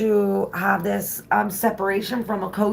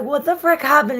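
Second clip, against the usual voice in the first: alarmed; 9 semitones higher, 4 dB louder than usual. Retold or imitated. Mom reacting to panicked teens.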